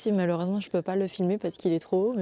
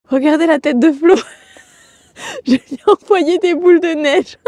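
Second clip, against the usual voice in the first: loud, high, giggly shouting; laughing while calling out; laughing excitedly; 7 semitones higher, 13 dB louder than usual. Real, not acted. Throwing snowballs at Flo, laughing.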